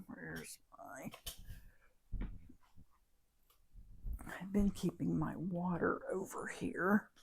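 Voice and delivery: hushed whisper while searching; soft whispered muttering; whispered aside. Real, not acted. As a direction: whisper it as you look around. Looking for her water, aside.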